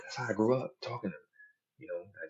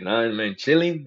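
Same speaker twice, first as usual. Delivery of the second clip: put-on Spanish-neighborhood accent, rising high and loud; imitated Spanish dude; 4 semitones higher, 6 dB louder, slower than usual. Spanish dude answering a greeting, imitated Spanish-neighborhood accent. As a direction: answer like a Spanish guy from the block. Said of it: "if I get around a Spanish dude".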